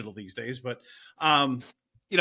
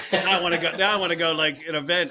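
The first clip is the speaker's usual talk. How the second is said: louder, excited burst of eagerness; worked up; 3 semitones higher, 5 dB louder than usual. Real, not acted. DJ telling the host how events get juices flowing, worked up. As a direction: get fired up and raring to go. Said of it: "you're seeing me get worked up now".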